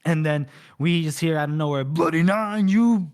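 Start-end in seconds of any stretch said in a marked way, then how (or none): 1.8-3.1 raised, high yell with a long drawn-out last word; mock battle yell. Scale charges at the Bloody Nine, mock battle yell. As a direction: holler it across a hall, hammed up. Scale roars insult out of nowhere.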